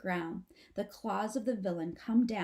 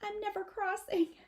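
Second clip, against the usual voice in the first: put-on high, small scared baby possum voice; a frightened little possum, under her breath; whispered to a friend; 13 semitones higher than usual. A narrator's reading. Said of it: "She whispers to Antonio".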